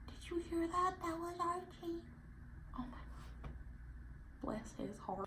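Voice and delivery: cooing aside about Archie, soft and high. Real, not acted. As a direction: softly point out the pet's noise.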